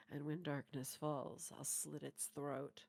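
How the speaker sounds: low, restrained trailer-line imitation; lightly acted low menace, slowing at the end; quoting trailer heroine. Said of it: "where she said".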